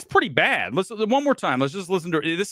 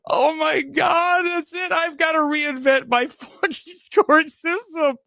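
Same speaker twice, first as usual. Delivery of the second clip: wheezing, high crying laughter; real laughter breaking every word; laughing too hard; 8 semitones higher than usual. Real, not acted. Host losing it over a silly review score, shrieking laughter. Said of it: "I'm laughing too hard".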